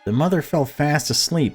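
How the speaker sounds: usual speaking voice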